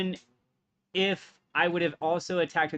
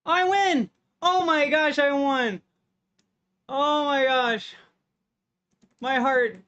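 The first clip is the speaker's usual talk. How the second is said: real squealing victory joy, much higher, louder; shrieking jackpot winner; 9 semitones higher, 6 dB louder than usual. Real, not acted. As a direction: ecstatic high shrieks of winning. Just won a close match.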